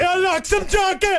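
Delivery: high, slow Jamaican vendor voice; Jamaican accent. Acted. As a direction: play a Jamaican jerky seller. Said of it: "let's do an act out".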